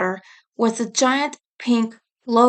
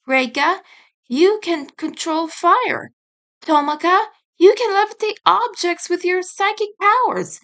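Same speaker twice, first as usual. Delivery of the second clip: higher, bright fairy announcing powers; a lightly voiced fairy guide; 7 semitones higher than usual. Rin assigns powers.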